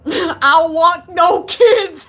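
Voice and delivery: slow, loud, breaking into laughter; laughing. Real, not acted. Streamer cracking up mid-question at chat, laughing loudly. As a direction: lose it laughing as you get it out.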